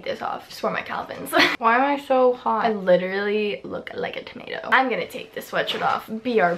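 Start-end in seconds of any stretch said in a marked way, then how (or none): 0.0-1.3 whisper. Real, not acted. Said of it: "I don't know why I'm whispering".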